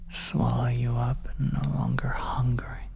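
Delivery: ordinary delivery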